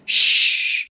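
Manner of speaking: shushing. A narrator's reading. Hushing sound in story.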